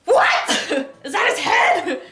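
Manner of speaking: high, loud shriek of disbelief; mock horrified shock. Girl finding a head in the bag, mock horrified. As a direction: shriek it in hammed-up horror.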